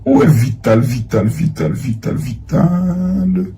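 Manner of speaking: soft murmured name, last one drawn out; searching singsong mutter. Real, not acted. Host looking for Vital's messages, murmuring the name to himself. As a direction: repeat it idly under your breath, stretching the end.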